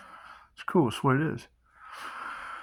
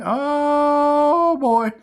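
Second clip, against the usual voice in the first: mock delighted; 5 dB louder than usual. Reacting to nursing answer.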